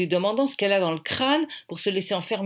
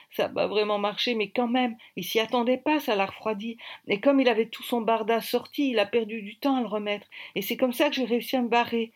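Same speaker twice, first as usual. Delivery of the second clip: put-on higher-pitched, animated girl's voice; eager account, pitched up; girl telling her escape; 5 semitones higher than usual. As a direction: play the girl recounting how she got away.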